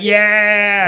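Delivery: high, drawn-out "yeah"; sing-song class reply; children answering in chorus. Acted. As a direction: answer like an eager kindergarten class. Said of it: "And they were like".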